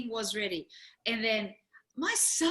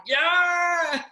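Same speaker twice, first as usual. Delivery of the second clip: raised, louder yeah; mock yell; 7 semitones higher, 8 dB louder than usual. Family yelling along at home, loud yeah. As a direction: shout it like the crowd. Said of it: "when we were yelling".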